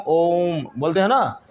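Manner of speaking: ओम stretched long and slow like a chant; drawn-out Om. Shown as a demonstration. Teacher showing the class a prolonged vowel, slow and drawn out. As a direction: hold the ओम out, slow and resonant. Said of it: "इस तरह का जब उच्चारण होगा तो अधिक समय लगेगा".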